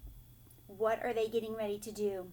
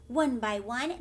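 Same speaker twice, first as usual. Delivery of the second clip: usual speaking voice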